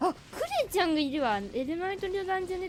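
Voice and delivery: high, loud, rushed outburst; excited surprise. Real, not acted. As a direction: blurt it out in a delighted rush. Suddenly spots a favourite game character.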